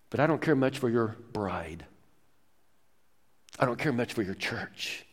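low, soft admission after a pause; quiet dismissal. Retold or imitated. Absent churchgoer admitting indifference to Jesus, quietly dismissive. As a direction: drop right down, imitating indifference.

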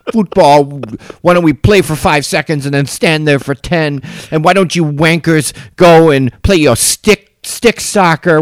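high, indignant mock-British rant; pissed-off British accent. Mocking an angry Brit's rant about American sports, angry British accent. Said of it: "pissed off British".

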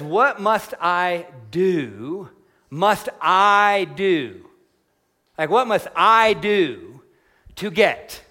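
very slow, dwelling on "What must I do"; drawn-out question. Shown as a demonstration. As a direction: stretch each word to make the point. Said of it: "Simple question".

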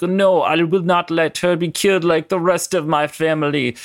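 Colossus voice. Retold or imitated. Colossus shielding Jubilee from Magneto, hammed-up heroic cry. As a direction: cry out like a protective big brother. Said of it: "Colossus jumps in and is like".

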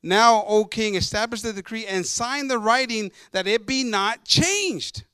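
loud, slow proclamation of the verse; declaimed Bible reading from the pulpit; scripture reading. Acted. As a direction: launch into the verse forcefully.

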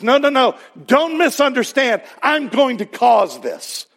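high, insistent acted correction; emphatic correction. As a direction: cut off the misunderstanding, then declare it firmly. Voices God correcting a misunderstanding.

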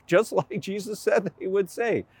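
ordinary delivery

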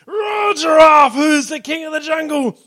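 high, loud, slow roaring shout; a lion shouting at the giraffe; lion's roar shout. Acted. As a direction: draw out the roar like a proud lion.

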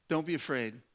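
ordinary talk, nothing marked

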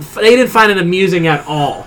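ordinary talk, nothing marked

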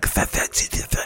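"th-, th-" as a string of voiceless tongue-between-teeth hisses; hissed TH sounds. Shown as a demonstration. Showing the TH Russians hear, "th-" hissed softly without voice. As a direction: repeat "th-" quickly with no voice, just air. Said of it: "they hear that T H sound like".

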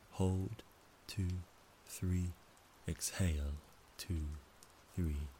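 calm, measured count with pauses; slow breath counting. Shown as a demonstration. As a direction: count each breath slowly and evenly. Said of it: "It goes like this".

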